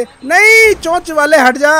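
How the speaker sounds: loud, high opening cry; loud warning yell; alarmed shout. Real, not acted. Alarmed at a truck coming at him.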